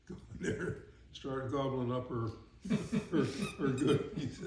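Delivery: chuckling through the words; real amused laughing. Real, not acted. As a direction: laugh while telling it. Amused retelling of boys' raid.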